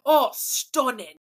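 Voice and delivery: exaggerated British foodie delight; mock British accent. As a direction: draw it out in a hammed-up British accent. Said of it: "That sounds more Australian".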